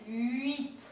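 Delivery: "Huit" said with the mouth pushed forward; lips forward huit. Shown as a demonstration. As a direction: round and push the lips for "Huit". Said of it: "poner la boca bien para adelante".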